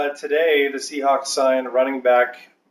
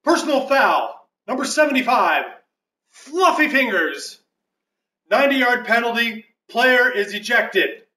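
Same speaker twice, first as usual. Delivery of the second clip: referee penalty announcement; 10 semitones higher than usual. Pretend. Mock referee, absurd penalty.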